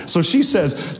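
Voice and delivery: ordinary talk, nothing marked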